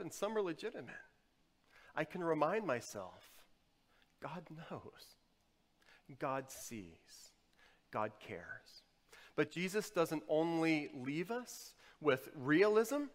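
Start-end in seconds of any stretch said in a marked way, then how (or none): 4.2-8.8 near-whispered short assurances with pauses between; hushed self-reminder. Acted. Preacher reminding himself when stressed, hushed self-reminder.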